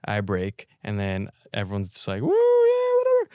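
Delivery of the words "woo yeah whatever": much higher and louder, whooping; hammed-up crowd cheer as he breaks; mock cheering. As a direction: friends whooping when he orders a drink, high mock cheer.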